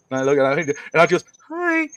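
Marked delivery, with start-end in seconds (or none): 1.5-1.9 very high, drawn-out little hello; girlish greeting. Retold or imitated. Daughter answering the boy at lunch, high girlish voice. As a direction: imitate a smitten girl's hello.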